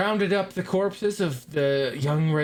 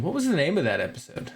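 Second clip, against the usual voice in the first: ordinary talk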